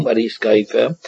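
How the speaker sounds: normal talking voice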